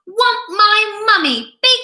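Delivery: put-on a baby wailing for her mother; whiny toddler. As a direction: wail like a toddler wanting mummy.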